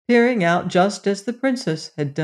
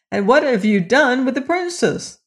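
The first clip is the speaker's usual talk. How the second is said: higher, urgent questioning; mildly alarmed prince, pitch raised; crying out; 6 semitones higher than usual. A narrator's reading. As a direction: demand an answer in alarm. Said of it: "he cried".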